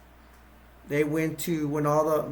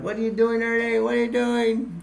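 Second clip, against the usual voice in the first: higher, retold alarm at Ernie; alarmed question; 8 semitones higher than usual. Bert confronting Ernie about the old lady, alarmed and high. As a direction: demand to know what he's doing, voice up high.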